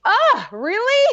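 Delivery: high, loud, drawn-out mock protest; hammed-up reluctant "do I have to"; reluctant disbelief. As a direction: whine it slowly, pitch way up. Acting out reluctant reply to advice.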